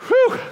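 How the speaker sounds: high, loud exhale of relief; relieved whew. Retold or imitated. Father reaching the top of the hill, loud high whew. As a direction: blow out hard like you've just made it. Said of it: "I was like".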